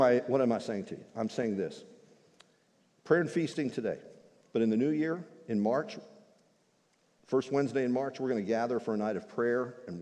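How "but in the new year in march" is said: usual speaking voice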